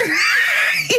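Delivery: high burst of laughter; laughing. Real, not acted. Host cracking up at the thumbs remark, high laugh.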